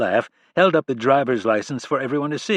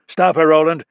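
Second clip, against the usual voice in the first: loud, raised command; sharp shouted order; 6 semitones higher, 8 dB louder than usual. A narrator's reading. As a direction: yell to stop her escaping. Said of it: "Rhyme shouted".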